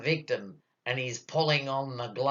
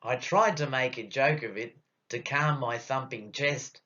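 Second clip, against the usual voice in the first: ordinary delivery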